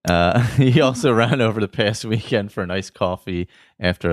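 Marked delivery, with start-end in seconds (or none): none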